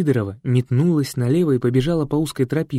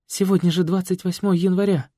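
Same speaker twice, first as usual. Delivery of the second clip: gasping; 7 semitones higher than usual. Acted. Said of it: "ахнула Катя".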